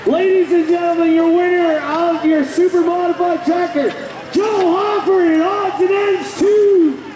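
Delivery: high, shouted winner call; tractor pull announcer. Acted. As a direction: belt the winner's name to the stands.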